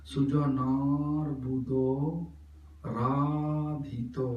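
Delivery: "Sujanar" and "Radhito" with drawn-out A; very slow, chanted syllables in "Sujanar Buddho Radhito"; long A. Shown as a demonstration. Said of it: "Follow my pronunciation". ("Sujanar" और "Radhito" में आ को लंबा खींचकर)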